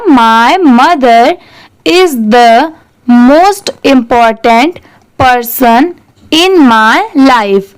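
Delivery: slow, clear model sentence for learners; careful teacher's read-aloud. Shown as a demonstration. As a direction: read it out slowly so students can repeat. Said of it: "इसे ऐसे पढ़ेंगे".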